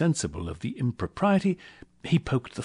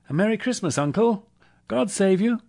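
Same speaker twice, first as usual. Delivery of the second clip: high, bright-spirited holiday greeting, louder; cheerful cry; 12 semitones higher, 5 dB louder than usual. A narrator's reading. Nephew greeting his uncle at Christmas, cheerful and high. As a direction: call out merrily, pitched well up. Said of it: "cried a cheerful voice".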